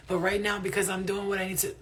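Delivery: ordinary delivery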